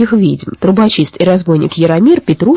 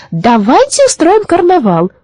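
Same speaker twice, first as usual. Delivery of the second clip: excited announcement; 10 semitones higher, slower than usual. A narrator's reading. Witch announces to animals.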